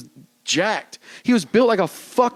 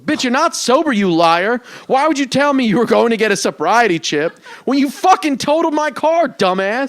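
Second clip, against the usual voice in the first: mock-angry outburst, raised pitch; retold angry rant; 5 semitones higher, 4 dB louder than usual. Driver confronts the woman who hit him, retold angry rant. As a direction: tell her off, slightly louder.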